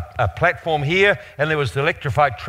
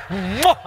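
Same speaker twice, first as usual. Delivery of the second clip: big spoken "mwah" kiss noise; mock kiss; 5 semitones higher, 3 dB louder than usual. Preacher imitates grateful man kissing his cheek, mock kiss. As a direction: smack out an exaggerated kiss.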